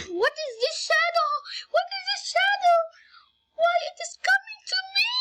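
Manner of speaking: very high panicked squeal; scared. A narrator's reading. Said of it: "she was so scared".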